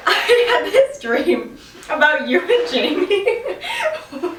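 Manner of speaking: breaking into giggles; words broken by laughter; laughing through the start of the dream story. Real, not acted. Laughing while telling dream.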